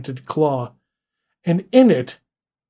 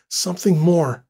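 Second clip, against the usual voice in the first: ordinary delivery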